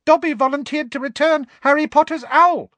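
high squeaky elf voice. Acted. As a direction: squeak it out with adoration. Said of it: "said the elf squeakily".

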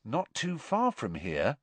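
measured, meaningful echo; knowing, pointed repetition; significant repeat. A narrator's reading. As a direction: repeat it as a hint to a friend. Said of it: "Ron repeated, looking significantly at Harry".

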